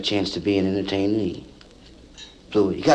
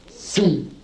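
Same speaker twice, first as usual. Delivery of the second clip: mock whoosh; 6 semitones higher than usual. Sound of car running light.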